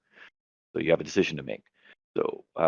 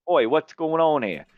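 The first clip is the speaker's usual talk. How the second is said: slow, higher cockney "Oi" challenge; a London copper, higher and slow; London guard; 10 semitones higher, 4 dB louder, slower than usual. Acted. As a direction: bark it like a London cop stopping them. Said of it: "So I say".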